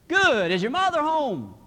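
high, loud phone voice; loud telemarketer. Acted. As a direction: quiz a child loudly down the line. Telemarketer calling a whispering child.

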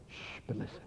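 shush, then whisper very softly; hushed whisper. Real, not acted. Preacher hushing the congregation before good news, whispered. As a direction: drop to a barely audible whisper to draw them in.

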